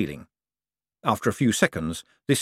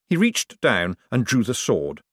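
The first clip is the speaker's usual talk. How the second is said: ordinary delivery, nothing marked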